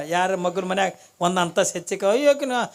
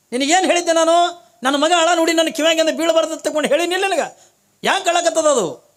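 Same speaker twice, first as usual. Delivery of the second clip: loud, high-pitched acted husband's rant; indignant, raised-voice demand for answers; angry scolding; 7 semitones higher, 5 dB louder than usual. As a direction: play a husband loudly telling off his wife. Husband scolds wife over crying son.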